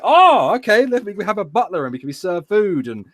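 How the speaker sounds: high-pitched mock excitement; delighted tourists. Tourists realise their dollars buy luxury, mock delight. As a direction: imitate thrilled newly rich travellers. Acted delighted characters in retold film.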